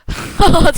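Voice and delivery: loud burst of laughter; big, sudden victory laugh; triumphant laugh. Real, not acted. Laughs after a kill.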